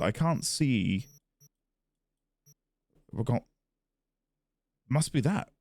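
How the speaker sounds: normal talking voice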